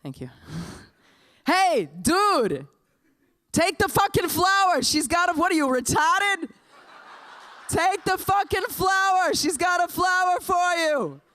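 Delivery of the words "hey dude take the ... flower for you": put-on high, loud Boston-accented heckling shout. Bystander in Harvard Square shouting after the dude, Boston accent. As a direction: yell it like a Boston street bystander. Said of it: "this is my best Boston accent".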